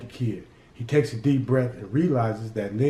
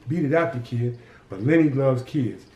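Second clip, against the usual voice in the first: ordinary delivery, nothing marked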